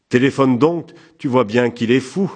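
alarmed wife calling out; urgent prodding. A narrator's reading. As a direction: urge your husband to act at once. Alarmed woman urges husband to phone.

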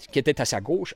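usual speaking voice

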